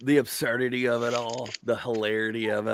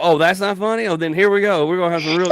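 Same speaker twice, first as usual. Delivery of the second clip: louder, higher stage voice; mock challenge; 7 semitones higher, 6 dB louder than usual. Comic facing a crowd that didn't laugh, loudly goading. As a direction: goad the silent crowd, hammed up. Retold challenge to silent audience.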